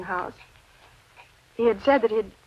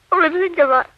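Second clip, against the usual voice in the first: tearful lament; 5 semitones higher, 4 dB louder than usual. Acted. Widow lamenting husband's death.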